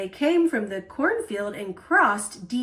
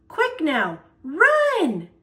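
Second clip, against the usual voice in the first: rising to a high, loud call on run; urging the girl to run after the bee, calling out; a shout that jumps up at the end; 6 semitones higher, 5 dB louder, much slower than usual. A narrator's reading. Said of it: "Grandpa called out".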